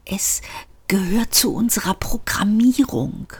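soft, haunted whispering; terrified whisper. A narrator's reading. Lift explains its programming, whispering in terror. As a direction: whisper it as if dreading what comes. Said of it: "es klang wie schreckliche Angst".

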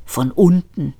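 plain reading voice

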